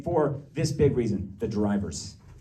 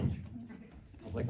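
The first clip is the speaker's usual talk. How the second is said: normal talking voice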